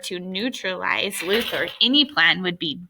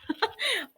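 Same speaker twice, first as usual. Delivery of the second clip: soft laugh; 14 semitones higher, 8 dB quieter than usual. Real, not acted. Reader laughs at scene.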